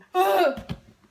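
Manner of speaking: loud high gasp of shock. Retold or imitated. Teen hearing her crush has a girlfriend, a loud shocked gasp. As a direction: gasp as if the news just hit you.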